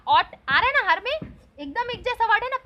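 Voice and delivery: usual speaking voice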